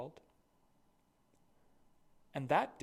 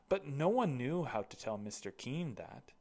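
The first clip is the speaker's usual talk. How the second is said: usual speaking voice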